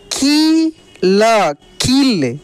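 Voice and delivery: very slow की ल, then joined कील; drawn-out spelling out. Shown as a demonstration. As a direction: hold की, add ल, blend into कील. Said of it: "खींच कर किया जाता है". (की ल कील, खींच कर)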